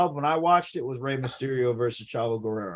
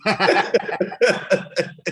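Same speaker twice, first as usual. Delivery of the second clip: high burst of amused laughter; helpless, higher-pitched laugh; 5 semitones higher, 4 dB louder than usual. Real, not acted. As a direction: crack up at the friend's delivery. Amused at the disgusted delivery.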